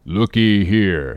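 slow, put-on harpooner's voice; Queequeg's voice. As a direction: drawl it slowly in character.

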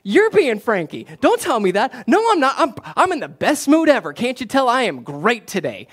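high, loud defensive protest; hammed-up indignant denial, pushed way up in pitch; mock-gracious snapping. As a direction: act out a grouchy dad loudly insisting he's great. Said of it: "in a gracious way".

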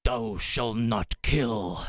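booming commandment voice. Pretend. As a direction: drag each word out like scripture. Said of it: "Do it again".

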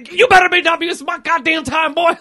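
high, loud fired-up cop outburst; angry cop. Retold or imitated. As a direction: imitate a furious cop chewing someone out. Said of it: "He's so angry".

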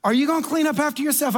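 high, quick challenge; mock-stern, pointed question; scolding manager. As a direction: turn the scolding on the next customer. Manager turns scolding on speaker.

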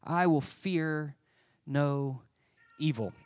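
"I will fear no evil" dropped word by word, slowly; solemn, spaced-out declaration; slow and deliberate. Shown as a demonstration. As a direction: lay each word down like something to underline. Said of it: "underline, highlight, circle".

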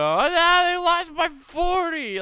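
very high, loud, drawn-out jeer; mocking gloating fan. Imitating family crowing about the loss, very high and mocking. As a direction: crow over the blowout. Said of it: "talking shit to me".

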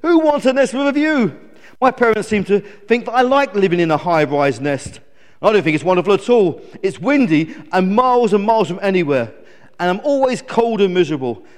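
whingeing storybook eagle; complaining. Acted. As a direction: moan indignantly at the visitor. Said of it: "complained Glen".

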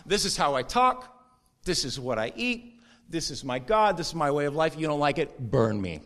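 put-on Daniel and his buddies in a swaggering New York accent. Preacher voicing Daniel's crew, a light New York accent. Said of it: "do a New York accent on accident".

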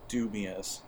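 normal talking voice

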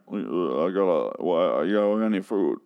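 lightly voiced Stallone, low and slow; a hint of a mumbling Stallone; Stallone impression. Shown as a demonstration. Vlogger slipping into a bad Stallone, lightly done. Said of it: "turning into Stallone there".

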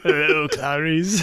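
slow, creepy Hello, Clarice; lightly voiced movie villain; creepy Hannibal quote. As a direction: drag it out like a horror villain. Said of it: "I was like".

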